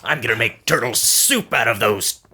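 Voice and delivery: slow put-on villain voice, lightly done; a cartoon brain villain growling a threat; lightly voiced cartoon villain; Krang impression. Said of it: "my Krang".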